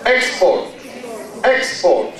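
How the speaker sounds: "Export" stressed on the first syllable, EX-port. Shown as a demonstration. Teacher drills the noun with the class, "Export" stressed EX-port. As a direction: say "Export" as a noun, EX strong, falling. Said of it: "how do we say this is a noun".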